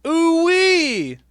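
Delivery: high shouted whoop; excited whoop. Pretend. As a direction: whoop it out with joy. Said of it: "can I get an ooh-wee".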